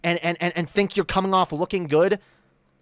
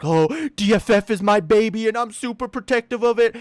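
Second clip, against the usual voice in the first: mocking imitation; 2 semitones higher than usual. Streamer imitates Paul being protective of D F F, mockingly. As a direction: parrot the opponent in a mocking voice. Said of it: "I heard Paul making a lot of arguments".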